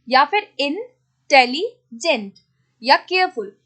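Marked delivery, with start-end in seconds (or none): 0.6-2.3 intelligent slowly as इन, tele, gent; slow syllable-by-syllable intelligent; split into syllables. Shown as a demonstration. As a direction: pause between the parts of intelligent. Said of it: "more than one syllable word".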